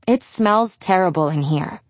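usual speaking voice

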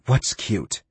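asked on a strained breath out; slightly strained, out of breath; strained exhale. A narrator's reading. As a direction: push the question out on an exhale. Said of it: "she asked on a strained exhale".

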